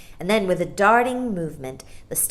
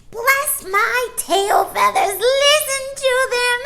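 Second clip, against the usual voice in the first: high, loud, slow bird shriek; shrieking starling; 9 dB louder, slower than usual. A narrator's reading. Starling shrieking at the babies, high and loud. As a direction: screech it like a mocking bird. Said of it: "shrieked the starling".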